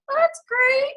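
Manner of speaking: high, sing-song fake cheer; a mock-bright that's great meaning the opposite; sarcastic chirp. Mom answering her coach's check-in with fake cheer. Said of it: "I'm like".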